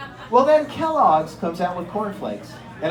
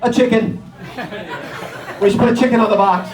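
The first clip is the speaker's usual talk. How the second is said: fast, loud, laughing eagerness; eager mock pitch; 3 dB louder, faster than usual. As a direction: blurt the idea proudly. Bill pitching idea.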